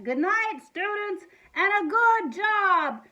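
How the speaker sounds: high, loud, stretched-out shout; yelling back. A narrator's reading. Principal on the roof yelling back to students, loud shout. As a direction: call down from far above. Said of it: "he yelled back".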